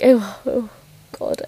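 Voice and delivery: normal talking voice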